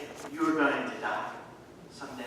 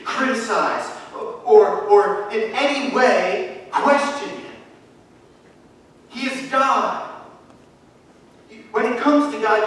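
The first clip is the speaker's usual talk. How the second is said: rising, louder insistence; building preaching; 5 semitones higher, 5 dB louder than usual. Real, not acted. Pastor insists to his church on obedience, fervent. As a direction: press the point home harder each line.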